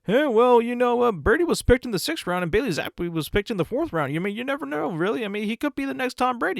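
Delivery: hammed-up broadcaster hype; mocking the announcers. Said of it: "Joe Buck and Troy Aikman were saying".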